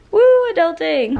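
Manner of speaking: loud, high whoop of mock triumph; mock cheer. After planning an early night, cheering adulting loudly. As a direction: cheer it like a tiny victory.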